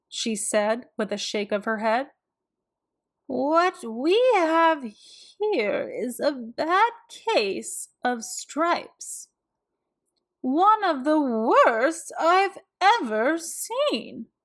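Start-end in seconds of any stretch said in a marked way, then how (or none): 3.3-14.3 slow, higher grandmotherly diagnosis; a sweet old lady tutting over a case; gentle old woman voice; kind old woman. A narrator's reading.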